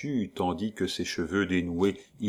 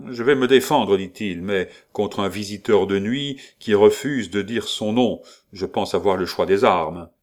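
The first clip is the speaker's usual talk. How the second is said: loud, sneering defiance; defiant shout; 5 dB louder than usual. Acted. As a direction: shout it back with contempt. Duke defying the stranger.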